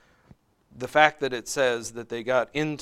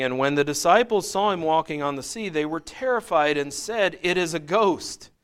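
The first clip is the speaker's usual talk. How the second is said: raised, measured Bible reading; slightly louder scripture reading voice; 4 semitones higher, 5 dB louder than usual. Acted. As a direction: read the passage out to the class. Reads scripture passage aloud.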